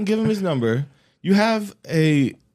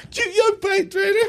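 high squealing laugh through the words; laughing shriek. Real, not acted. Reading a gross ad to a friend, squealing with laughter.

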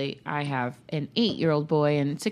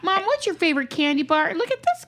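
put-on much higher whiny child voice; imitating her kid; 10 semitones higher than usual. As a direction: play the pestering kid at the checkout. Kids nagging at the checkout.

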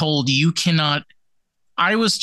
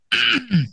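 coughing; 10 semitones higher than usual. Real, not acted. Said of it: "there we go again".

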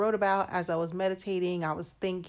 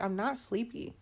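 usual speaking voice

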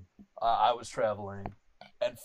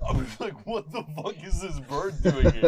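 laughing; 4 semitones higher, 5 dB louder than usual. Real, not acted. Laughs retelling his reaction.

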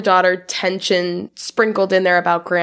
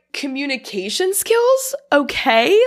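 impressed; 7 semitones higher than usual. Retold or imitated. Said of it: "I was like".

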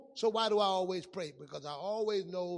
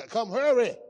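loud urgent call across the room; 3 semitones higher, 11 dB louder than usual. Retold or imitated. Pastor calling Isaac to come eat, loud call. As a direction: shout for him to hurry over.

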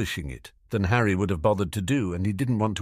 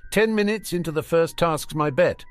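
confident; 9 semitones higher, 4 dB louder than usual. Acted. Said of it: "Draco said confidently".

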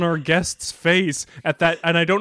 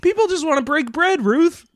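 high pleading; 12 semitones higher than usual. Pretend. Mock pleading for bread.

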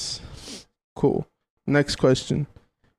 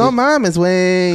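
Chilango accent; 3 semitones higher, slower than usual. Shown as a demonstration. Giving friends a Chilango example on request, hammed-up accent. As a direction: ham up a Mexico City Chilango accent. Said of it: "Give me a Chilango example".

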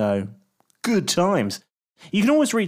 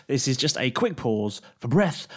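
ordinary talk, nothing marked

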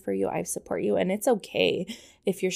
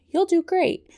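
cheery parent; 11 semitones higher, 6 dB louder than usual. Retold or imitated. Parent quote repeated.

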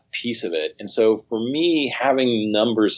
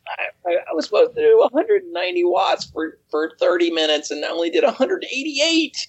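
imitating tearful athlete; 8 semitones higher, faster than usual. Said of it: "She's like".

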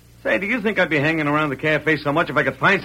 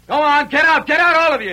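higher, louder, furious order; angry shout; 8 semitones higher, 4 dB louder than usual. Acted. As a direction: drive them out of your cabin. Accused man ordering posse out.

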